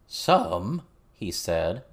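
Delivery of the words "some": a hint of froggy goblin, louder and higher; lightly croaked goblin voice; lightly voiced frog-like goblin, pitched up; croaking goblin. A narrator's reading. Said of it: "in his frog's croak of a voice".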